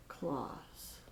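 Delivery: soft, breathy spooky whisper. A narrator's reading. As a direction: let the last word fade into a whisper. Scary build in picture book.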